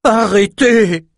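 high desperate plea, slow; pleading. A narrator's reading. Ren begs the soldiers to stop, high desperate plea. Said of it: "implora Ren".

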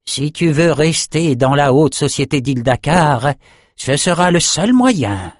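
high, pointed threat slowing at the end. A narrator's reading. André threatens Nathan's place in society, high unfriendly warning. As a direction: lay down the condition as a veiled warning. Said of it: "soudain beaucoup moins amical".

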